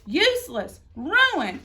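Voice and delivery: ordinary delivery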